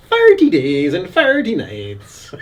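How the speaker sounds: slow Irish Thirty for forty; drawn-out Irish cinema announcement; Irish accent. Retold or imitated. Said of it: "just full-on Irish a-, accent".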